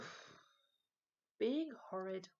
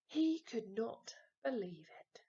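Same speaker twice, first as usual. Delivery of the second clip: usual speaking voice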